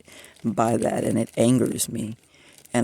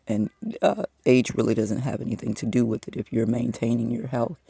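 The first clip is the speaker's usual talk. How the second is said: ordinary talk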